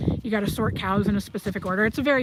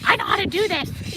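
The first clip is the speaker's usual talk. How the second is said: much higher, sassy mare voice; horse's voice; 10 semitones higher than usual. Retold or imitated. Squid informing the rider she knows the job, high sassy voice. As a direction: voice the mare telling him she's in charge. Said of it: "She told me at the beginning".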